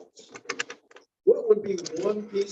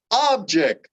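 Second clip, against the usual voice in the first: "Object" with a high, strong OB, falling away; "Object" stressed up front on the OB; stress on first syllable; 5 semitones higher, 3 dB louder than usual. Shown as a demonstration. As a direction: say "Object" as a noun, OB-ject. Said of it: "the emphasis on the first syllable".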